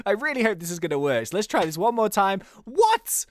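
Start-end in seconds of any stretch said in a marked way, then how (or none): 2.6-3.3 sudden high startled squeak; shocked what. Real, not acted. Gamer seeing the summon fail, startled squeak. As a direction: jolt up in disbelief.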